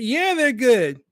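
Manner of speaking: mock owner reply. Mock owner answering his own question with a touch of smugness. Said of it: "Well, are they good?".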